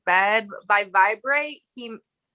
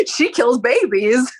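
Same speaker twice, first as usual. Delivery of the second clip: slow, louder hammed-up accusation; mock accusation; 6 dB louder, slower than usual. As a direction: draw it out like a joking scandal. Jokingly voices a scandalized accusation.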